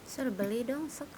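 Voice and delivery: ordinary talk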